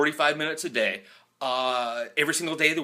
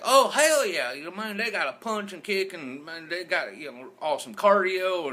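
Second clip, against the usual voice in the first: put-on Southern accent; 7 semitones higher than usual. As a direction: gush about fighters in a Southern accent. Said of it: "He's like".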